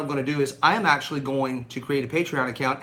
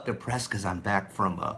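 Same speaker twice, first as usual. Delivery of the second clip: a touch glum; 10 semitones lower, 10 dB quieter than usual. Real, not acted. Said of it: "I'm a little depressed".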